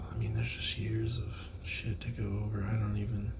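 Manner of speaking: breathy half-whisper, almost to himself; whispered aside. Real, not acted. Confessing his fuck ups in a whisper. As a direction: drop to a whisper over the confession.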